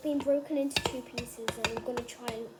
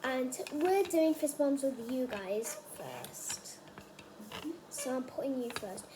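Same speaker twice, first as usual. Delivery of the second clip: whispering. Real, not acted. Whispered aside to viewers.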